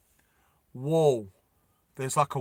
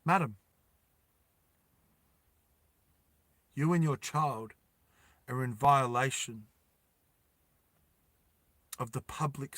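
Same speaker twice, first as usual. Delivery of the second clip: official; 3 semitones lower, 6 dB quieter than usual. Acted. Official drone voice held.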